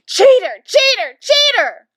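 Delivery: high, loud accusing chant; shouting. A narrator's reading. Mother kicks at the locked bathroom door, shouting. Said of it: "kicked at the door and shouted".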